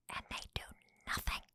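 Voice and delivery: whispered aside, barely voiced; hushed whisper. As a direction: whisper it like a private, exasperated thought. Voicing private thoughts, hushed.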